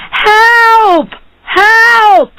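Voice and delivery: high, loud cry for help; shouting for help. A narrator's reading. Barber at the door raising the alarm, shouting. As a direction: yell out in alarm, high and drawn. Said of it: "began to shout".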